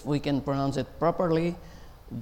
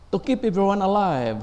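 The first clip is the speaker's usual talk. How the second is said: ordinary talk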